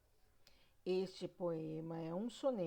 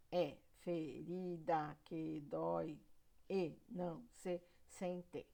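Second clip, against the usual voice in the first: "É ferida que dói e não se sente" slowly chopped into syllables; sílaba por sílaba; 4 semitones lower, slower than usual. Shown as a demonstration. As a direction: split every syllable apart, evenly and slowly, down to "sen-te". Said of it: "Eu dividi todas as sílabas". (verso escandido devagar, sílaba por sílaba)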